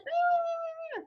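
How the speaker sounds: high squeaky giggle. Shown as a demonstration. Laughs after tiny voice demo.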